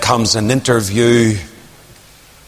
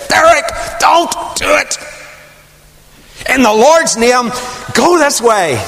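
urgent warning; 11 semitones higher than usual. Retold or imitated. Godly older woman stopping Derek, high urgent call. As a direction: shout him off the wrong path.

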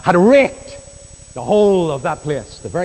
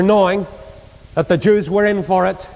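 ordinary talk

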